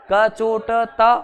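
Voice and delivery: कचोटता drawn out slowly, syllable by syllable; slowly spelt out. Shown as a demonstration. Teacher slowly spelling out a memory word for students. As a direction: stretch कचोटता into its parts. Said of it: "ऐसे याद कर लो".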